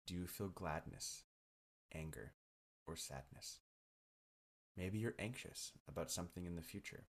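calm the listener gently, unhurried; guided meditation. Acted.

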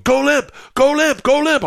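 high, loud repeated trainer's call; urgent shouted command. Retold or imitated. Trainer calling to him under the bear, urgently shouting. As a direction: imitate a trainer yelling instructions.

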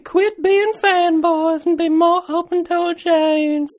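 put-on lady falsetto sliding into creepy old man; high, slow, slightly lecherous old voice; perverted old man. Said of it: "perverted old man".

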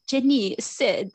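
English words in an imitated French accent. Car reads out a friend's text, French accent. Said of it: "like, in a French accent".

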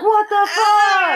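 slow, high, loud anguished cry of pain. Retold or imitated. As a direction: drag out the shocked cry like she's in agony. Tortured woman cries out in story.

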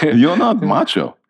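high, slow mock-Israeli accent. Actor re-quoting the Israeli guy's blunt verdict, Israeli accent. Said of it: "that's very Israeli".

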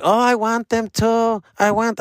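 put-on a high, slow little kid's whine; imitating little Nicolas. Uncle mimics the little boy begging for slides, high kid voice. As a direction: squeak it out like a small boy wanting his toy. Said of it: "Nicolas like".

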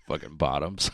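usual speaking voice

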